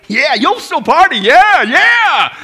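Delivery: loud, high hammed-up party whoop; mock party cheer. Preacher joking that old folks still party, mock whooping.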